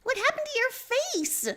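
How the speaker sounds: high, shouted question; shouting. Acted. Nepeta noticing the wound, shouting high. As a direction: call it out high in alarm. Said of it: "Nepeta shouts".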